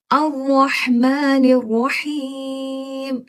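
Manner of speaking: very slow measured recitation for learners to repeat; Quran recitation. Shown as a demonstration. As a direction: recite slowly and clearly for students. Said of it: "दो lines पढ़ती हूँ, गौर से सुने". (धीमी साफ़ तिलावत, दोहराने के लिए)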